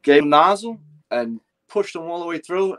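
usual speaking voice

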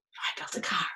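whispered refrain. Acted. Teacher rereading the refrain in a whisper. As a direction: breathe the line softly.

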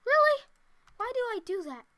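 high, loud "really", then easing down; exasperated disbelief. Real, not acted. Player scolding themself for a mistake, exasperated disbelief.

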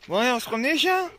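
high, loud call to the dogs. Real, not acted. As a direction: call the pack cheerfully. Calling the dogs. (appeler les chiens, fort et aigu)

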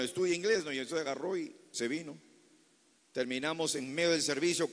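usual speaking voice

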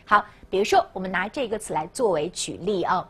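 ordinary delivery

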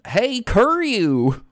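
high, very slow goofy call; hammed-up high Goofy greeting. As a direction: drag it out like Goofy. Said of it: "I can't do Goofy voice".

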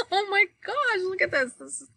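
high-pitched gushing with a laugh; laughing, high, amazed exclamation, tailing off; expressive reader's delight. Acted. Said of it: "I'm expressive. I make noises".

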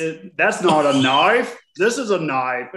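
slow, hammed-up Australian accent doing the knife line; mock Crocodile Dundee Australian, drawn out. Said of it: "I can only do an Australian accent".

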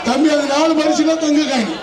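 ordinary talk, nothing marked

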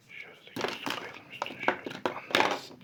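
barely audible whisper; secretive whisper. Real, not acted. Secretly hiding items from child.

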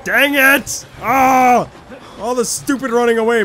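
loud, high outburst of frustration; frustrated shout. Real, not acted. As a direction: yell out in exasperation. Frustrated at the game.

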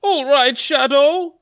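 high, loud cartoon agreement; Mario. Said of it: "And then Mario goes".

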